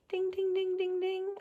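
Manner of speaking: high, soft sung ding ding; teacher chimes a playful ding. Real, not acted. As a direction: chime like a wrong-answer bell. Reader marks a misread.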